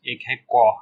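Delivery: 'कॉ' starting with a k stop; hard C sound. Shown as a demonstration. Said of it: "सी का दो sound है". ('कॉ' में क की ध्वनि अलग दिखाएँ)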